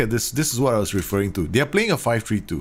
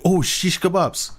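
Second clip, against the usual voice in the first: higher, slow startled "Oh, shish kebabs"; startled exclamation; 8 semitones higher, slower than usual. Real, not acted. Coach spotting his face on stream, surprised. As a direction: caught out, real surprise.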